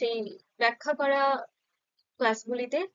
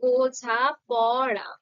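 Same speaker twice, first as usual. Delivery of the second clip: বোঝা পড়া said very slowly, split into its syllables; syllables drawn out; 4 dB louder, much slower than usual. Shown as a demonstration. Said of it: "এখানে কি কোথাও আটকাচ্ছে উচ্চারণের ক্ষেত্রে".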